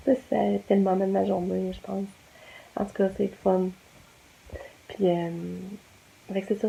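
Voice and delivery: soft, quiet night talk; soft goodnight. Real, not acted.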